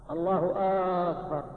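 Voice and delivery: الله أكبر as "allahu aaakbar", first A of أكبر held; faulty takbir with long "aa" opening أكبر; hamza of akbar lengthened. Shown as a demonstration. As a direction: draw out the start of أكبر. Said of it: "مد همزة أكبر".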